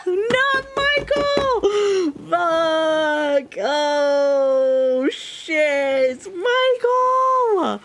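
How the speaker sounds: high, loud, drawn-out crying shout; anguished wail. Real, not acted. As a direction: wail the name in betrayal. Reacting to Michael being killer.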